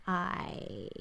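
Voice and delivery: drawn-out American "I", low; overdone American vowel on "I"; exaggerated American I. Shown as a demonstration. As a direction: say "I" with an exaggerated standard American accent. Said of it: "like, the I, it's like".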